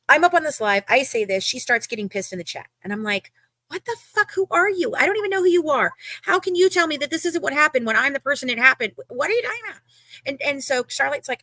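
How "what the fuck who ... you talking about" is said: high-pitched, incredulous confrontation; indignant retort. Retold or imitated. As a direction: retell it as an outraged challenge. Retelling her angry chat confrontation.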